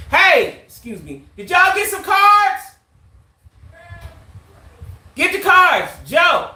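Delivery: loud, high call across the room. Real, not acted. Streamer calls friends to bring the cards, loud. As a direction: call to the people outside.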